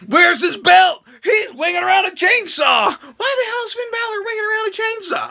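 incredulous high shout; shocked outburst. Real, not acted. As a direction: yell it like you can't believe your eyes. Shocked commentary on entrance.

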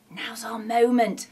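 urgent conspiratorial whisper; hissed whisper. Acted. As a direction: whisper it like a sneaky plotter. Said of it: "hissed Shifty".

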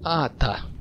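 normal talking voice

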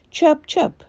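high, light chirp chirp; bird chirping. A narrator's reading. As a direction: chirp like a small bright bird. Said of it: "she said".

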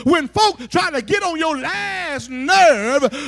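very loud, emphatic sermon climax; forceful preaching. Acted. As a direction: drive each phrase home to the congregation.

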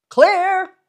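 loud, high answering shout. A narrator's reading. A carnival hand answering across the midway, loud high shout. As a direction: shout it back from far off. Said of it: "someone shouted".

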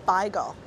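bagel said as "BY-gul"; Yiddish-style beigel. Real, not acted. Guide names the East End sandwich, bagel said "BY-gul". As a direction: say bagel with an "eye" vowel, "BY-gul". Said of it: "you heard me say that correctly".

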